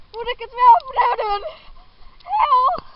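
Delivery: loud. Real, not acted. Exclaiming while running.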